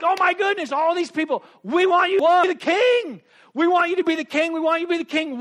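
high, loud clamoring crowd voice; eager crowd shouting. Acted. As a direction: beg him loudly to be king. Crowd begging Jesus to rule.

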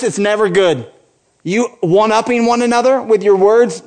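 ordinary delivery

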